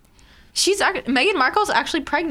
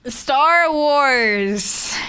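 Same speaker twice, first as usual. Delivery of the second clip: excited; 3 semitones higher, 3 dB louder than usual. Real, not acted. Fanatic reacts to Star Wars mention.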